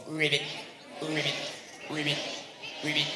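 spoken ribbits in a steady rhythm; frog croaks. Acted. Storyteller voicing pond frogs, steady ribbits.

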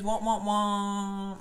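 high, drawn-out "wah" trombone; sad trombone sound. Pretend. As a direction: imitate a failure sound effect. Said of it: "wish we had that sound effect".

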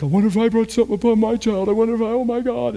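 high, rushed, fretting worrier; mock panic. Congregant fretting over a child after teaching, mock panicked. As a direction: imitate a fast, high-pitched anxious wail. Mocks listeners' condemned overreaction.

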